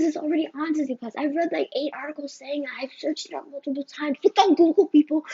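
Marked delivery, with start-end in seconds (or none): 4.2-5.2 louder exasperated plea to viewers; exasperated outburst. Real, not acted. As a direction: throw it out loud, fed up.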